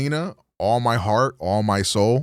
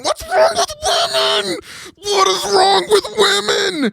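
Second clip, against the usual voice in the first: much higher, loud cry through laughter; laughing shout; 5 dB louder than usual. Real, not acted. Venting about his girlfriend's dry texts, real laughing shout, much higher. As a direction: wail it while cracking up.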